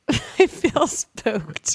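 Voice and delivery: slow, laughing echo of "I feel spooked"; laughing mock spooked. Repeating with a laugh.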